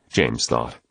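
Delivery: ordinary delivery, nothing marked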